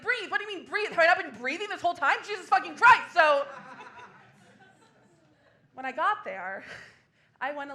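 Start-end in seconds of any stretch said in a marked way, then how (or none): 0.0-0.3 "Breathe?" thrown back in disbelief; "Breathe?" echoed with mock outrage; incredulous echo. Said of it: "And I was like". 0.3-3.1 higher, louder, faster building outrage; comic rant at a meditation app building to a shout; mock exasperated rant. Ranting back at meditation app.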